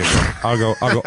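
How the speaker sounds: usual speaking voice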